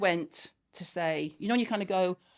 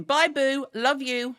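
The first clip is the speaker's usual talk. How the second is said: high, louder singsong call; pointed sweet goodbye; 6 semitones higher, 5 dB louder, much slower than usual. Retold or imitated. Mum calling after daughter leaving, high singsong. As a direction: call it out with mock affection.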